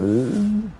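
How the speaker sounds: high whiny ugh; mock groan. Streamer mocks a player running in circles, high whiny groan. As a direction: groan like a frustrated kid.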